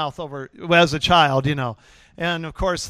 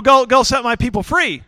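high, loud order; commanding shout; 7 semitones higher, 7 dB louder than usual. Acted. God sending Moses on a mission, commanding shout. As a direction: call out a bold command.

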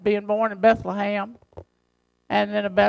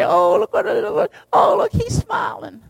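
put-on high cooing over a baby, much higher; doting baby talk; 10 semitones higher than usual. Parents cooing over baby Jesus, high mock coo. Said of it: "pinch his cheek and say".

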